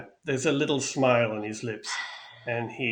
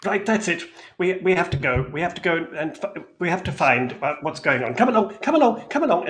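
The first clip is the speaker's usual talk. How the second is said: much higher and louder, hurried; flustered Mister Light; 7 semitones higher, 6 dB louder than usual. Acted. Panicked Mister Light hurrying Mister Witch away. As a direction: bustle everyone out in a panic.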